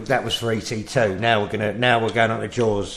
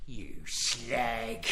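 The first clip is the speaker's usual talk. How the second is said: softer, drawn-out Cockney insult; 3 semitones lower, 11 dB quieter than usual. Acted. Shark looming over its victim, Cockney shark voice.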